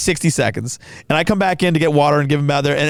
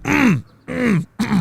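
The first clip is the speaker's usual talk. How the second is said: high closed-mouth choking hums; mimed choking grunts; 7 semitones higher, much slower than usual. Retold or imitated. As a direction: grunt urgently as if unable to speak. Imitates friend unable to speak, choking.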